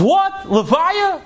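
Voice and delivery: high, incredulous questioning; acted shock at terrible news; shocked disbelief. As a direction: react in stunned disbelief, pitch leaping up. Retold news of a funeral.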